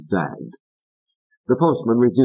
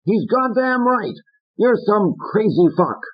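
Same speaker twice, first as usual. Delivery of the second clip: high, furious puppet yell; Fats yelling. Acted. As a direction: rant at Corky, slowing on the curses. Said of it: "Fats was on him".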